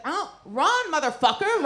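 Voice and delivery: high, loud yelled warning; urgent yell. Retold or imitated. Teacher yelling to the kid to run away, high and loud. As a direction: shout it as you bolt, laughing.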